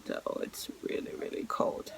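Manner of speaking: hushed whisper about the cold. Real, not acted. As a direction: drop to a whisper. Whispered aside.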